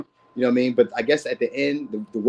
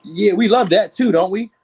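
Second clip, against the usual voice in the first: higher, louder, slower delight; excited gush; 7 semitones higher, 7 dB louder than usual. Real, not acted. Reader delights in the signs to viewers, excited and gushing. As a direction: gush with teasing glee.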